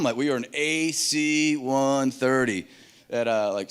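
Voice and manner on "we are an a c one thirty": very slow, spaced-out words; drawn-out radio identification; slow, careful explaining. Retold or imitated. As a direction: spell it out for a foreign listener. Spelling it out for French listener.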